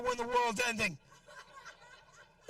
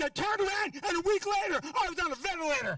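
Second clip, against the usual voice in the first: mock shout; 7 semitones higher than usual. Shouting the beans story.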